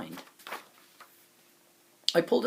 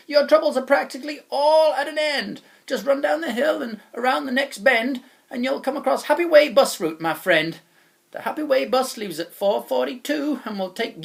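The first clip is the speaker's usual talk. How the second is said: loud, higher, hearty salesman voice; jolly wobble chap; 7 semitones higher, 7 dB louder than usual. Acted. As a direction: sell the dream of Solosaloo. Chap sends boy away.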